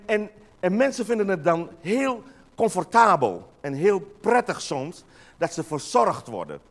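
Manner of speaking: ordinary talk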